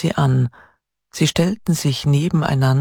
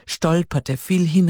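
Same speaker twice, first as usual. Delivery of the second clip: usual speaking voice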